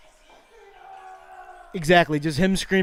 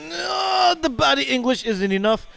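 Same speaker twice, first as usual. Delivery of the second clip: hushed golf commentary. Acted. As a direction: murmur the play-by-play so as not to disturb the golfer.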